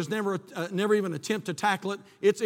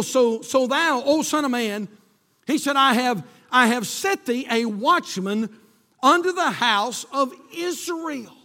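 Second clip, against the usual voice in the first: raised, ringing scripture proclamation; preaching; 6 semitones higher, 5 dB louder, slower than usual. Acted. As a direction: declaim it to the congregation, higher and drawn out. Preacher proclaiming God's words.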